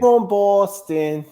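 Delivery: high, slow, hammed-up Boston accent; a clumsy fake Boston accent; mock Boston accent. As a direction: stretch out From Boston in a bad Boston accent. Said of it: "I can't do a Boston accent".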